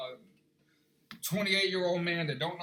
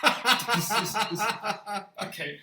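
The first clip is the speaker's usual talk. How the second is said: laughing; 3 dB louder than usual. Real, not acted.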